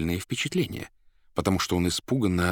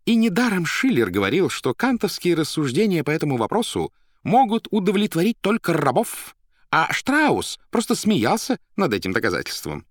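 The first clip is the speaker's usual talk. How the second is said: high, loud, lecturing editor; образованный редактор; 12 semitones higher, 6 dB louder than usual. A narrator's reading. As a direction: dismiss it in a pitched-up learned voice with a thin smile. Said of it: "тонко улыбнувшись, возразил образованный редактор". (высокий громкий голос учёного спорщика)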